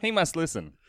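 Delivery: usual speaking voice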